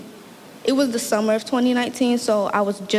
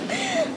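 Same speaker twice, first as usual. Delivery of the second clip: nervous laugh; 4 dB quieter than usual. Real, not acted.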